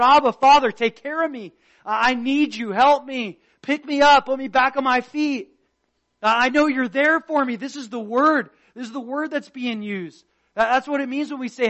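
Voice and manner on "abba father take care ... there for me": put-on a child calling to his dad, pleading; crying child. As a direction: plead like a hurt kid crying out. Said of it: "he's crying and he's sad".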